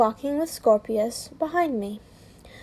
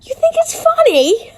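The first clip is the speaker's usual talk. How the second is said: very high, loud indignant question; angry yell; 13 semitones higher, 6 dB louder than usual. A narrator's reading. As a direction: shriek it in outrage, cracking up after. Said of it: "He yelled".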